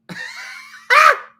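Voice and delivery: loud, high shriek of laughter. Real, not acted. Remembering the Beans guy, a shrieking laugh. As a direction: burst into a squealing belly laugh.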